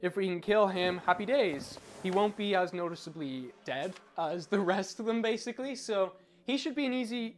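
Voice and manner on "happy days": ordinary delivery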